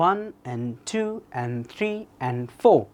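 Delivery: slow bouncing count, "and" dropped low to "en"; rhythmic sentence stress. Shown as a demonstration. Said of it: "রিদমের মতো কাজ করে". (তালে তালে, সংখ্যায় জোর, "and" নিচু "এন")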